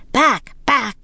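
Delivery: high, forceful command; two sharp, high shouts; shouted. A narrator's reading. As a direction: drive the monsters off with each word. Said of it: "they shouted".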